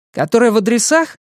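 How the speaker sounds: high, quick businesslike query. A narrator's reading. Pat checks a symbol of the key, high and businesslike. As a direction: pipe up high and brisk, checking a detail. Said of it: "деловито спрашивает Пат".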